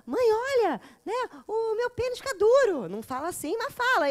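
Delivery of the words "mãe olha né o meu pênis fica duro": put-on high, loud boyish voice, slowing on the end; excited little boy. Little boy telling his mother, high excited voice. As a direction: play a small boy eager to tell. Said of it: "os meninos contam".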